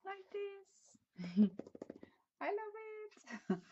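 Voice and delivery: high squeal of delight. Real, not acted. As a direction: squeak it out with joy. Delighted showing the bag.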